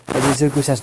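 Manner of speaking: usual speaking voice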